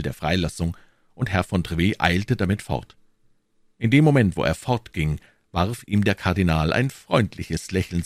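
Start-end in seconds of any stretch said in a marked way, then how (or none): none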